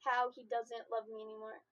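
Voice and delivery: ordinary delivery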